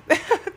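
short high laugh; quick laugh at your own slip; brief embarrassed giggle. Real, not acted.

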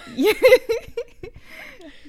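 high, loud giggle; high burst of laughter. Real, not acted. Talking about dialect words with a friend, laughing high.

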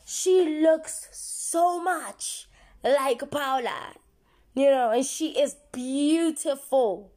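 slow, high, stretched out; emphatic excited repeat. Shown as a demonstration. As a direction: drag it out, amazed. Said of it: "repeat for emphasis".

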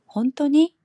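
high, loud surprised 本当に?; surprised question. Shown as a demonstration. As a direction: gasp out 本当に? in disbelief. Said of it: "이게 표준어라면".

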